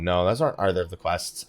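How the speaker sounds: normal talking voice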